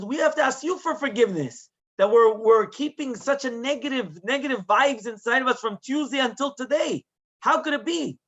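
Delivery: high, impassioned mock-tearful appeal; retold tearful plea. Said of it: "The rabbi starts crying".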